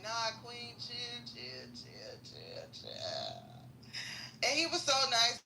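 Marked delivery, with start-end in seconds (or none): 0.0-3.4 soft soothing chill, sinking low and quiet; calm man easing her off; calming brother. Retold or imitated. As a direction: talk her down gently. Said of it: "the brother came and was like".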